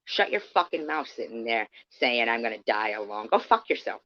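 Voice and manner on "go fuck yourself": sharp, higher angry retort; real anger, pitch jumping up; angry outburst. Real, not acted. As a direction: snap it straight at her. Furious at Courtney.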